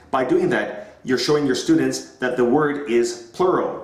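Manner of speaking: usual speaking voice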